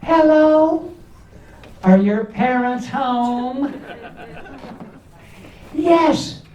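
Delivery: high squeaky voice, slow at first; squeaky phone voice. Retold or imitated. Comic answering a caller in his squeaky voice. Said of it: "Or worse yet".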